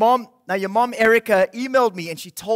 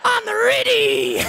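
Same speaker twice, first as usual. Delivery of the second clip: much higher, slow puppet wolf drawl; 11 semitones higher, much slower than usual. Acted.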